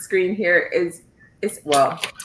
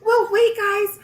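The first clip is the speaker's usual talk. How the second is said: mock protest. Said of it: "And I'm like".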